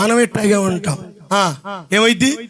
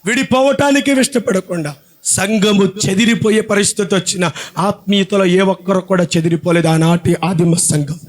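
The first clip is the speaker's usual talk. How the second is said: normal talking voice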